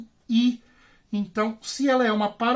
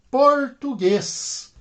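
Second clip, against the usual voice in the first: português stretched slowly, syllable by syllable; very slow, higher teaching delivery of português; stress on last syllable; 5 semitones higher, much slower than usual. Shown as a demonstration. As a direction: draw out português, landing on the final GUÊS. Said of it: "as sílabas tônicas são as últimas".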